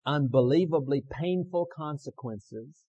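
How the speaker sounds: loud, slow, weighty lead; leading a recitation. Shown as a demonstration. Preacher giving the congregation words to repeat, loud slow lead. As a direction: call it out slowly for them to echo. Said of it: "And then repeat with me".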